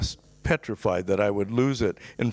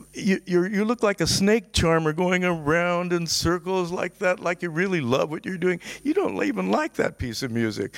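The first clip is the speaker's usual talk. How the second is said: mocking taunt; 7 semitones higher than usual. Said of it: "and he's laughing".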